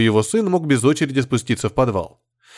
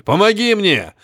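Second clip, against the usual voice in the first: urgent orders; 6 semitones higher than usual. Acted. Urgent shouting amid wreckage.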